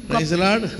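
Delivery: ordinary delivery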